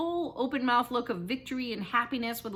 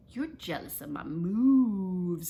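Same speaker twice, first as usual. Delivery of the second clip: drawn-out moves; 3 semitones lower, much slower than usual. A narrator's reading. Boy teasing the narrator about his dance, drawling moves. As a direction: stretch moves out long and slow. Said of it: "spread out the word moves".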